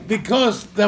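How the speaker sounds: normal talking voice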